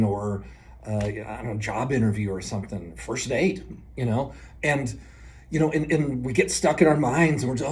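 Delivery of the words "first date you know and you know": usual speaking voice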